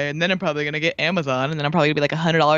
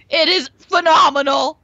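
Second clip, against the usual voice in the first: excited; 11 semitones higher, 7 dB louder than usual. Real, not acted. Raving about a movie.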